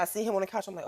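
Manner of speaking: ordinary delivery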